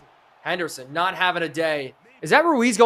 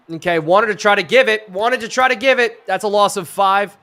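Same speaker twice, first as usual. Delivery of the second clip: loud, raised, repeated call; excited play call; 3 semitones higher, 6 dB louder than usual. Real, not acted. Streamer calling a loss on the play, excited. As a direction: call the stuffed play with punch.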